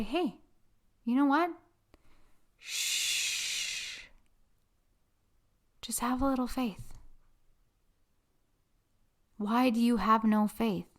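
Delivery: soft, hushed soothing voice; calm someone down with a whisper; gentle hush. Acted. Jesus soothing a frightened listener in a hush.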